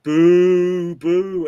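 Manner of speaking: loud drawn-out mock boo; crowd booing. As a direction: imitate an audience jeering the act. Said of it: "they're like".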